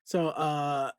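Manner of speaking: ordinary talk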